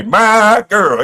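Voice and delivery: high, loud mock-happy call; happy imitation. Streamer mimicking TJ's happy reaction, high mock delight. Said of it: "he was just happy".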